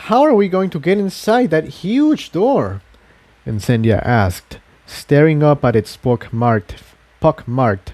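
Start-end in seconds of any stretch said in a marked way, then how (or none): 0.1-2.8 lightly raised, quick questioning voice; puzzled filly asking fast; a hint of an eager young mare; curious young mare. Incendia eyes the door.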